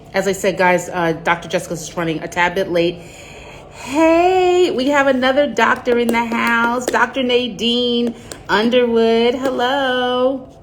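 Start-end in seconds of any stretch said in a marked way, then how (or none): 3.0-10.5 high, bright-eyed shout-out to a new arrival; excited announcement. Real, not acted. Host announcing a doctor joining the live stream, excited. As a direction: announce the guest with a thrill.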